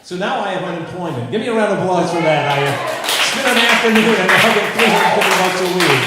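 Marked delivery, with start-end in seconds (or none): 1.3-6.1 loud, higher call to the crowd; rally the room for applause. Real, not acted. Comedian loudly working the crowd for applause.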